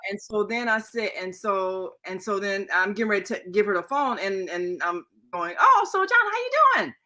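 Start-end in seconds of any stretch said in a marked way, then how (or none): 5.6-6.9 much higher, sing-song hello; high cheerful greeting. Retold or imitated. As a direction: greet the caller brightly up high. Mother's voice greeting caller.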